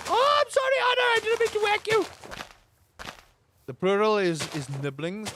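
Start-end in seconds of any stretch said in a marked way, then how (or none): 0.1-2.0 much higher, fast put-on comic voice; Uncle Roger apology. Uncle Roger apologizing for a pie review, squeaky and fast. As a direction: squeak out a mock apology in a funny voice. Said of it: "he would have to actually, like".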